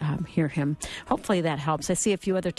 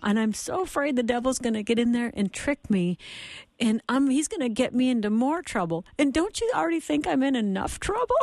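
put-on higher, lightly tearful little-boy voice; imitating bawling child; 6 semitones higher than usual. Mother quoting her son's fear of the devil, mock-tearful pleading. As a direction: plead like an upset child, half-acted. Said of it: "he's bawling".

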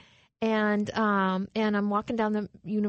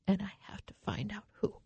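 quick, soft whisper to oneself; hushed inner thought; 6 dB quieter, faster than usual. Retold or imitated. Woman thinking who could love her, whispered resolve. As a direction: whisper a private resolve, retold.

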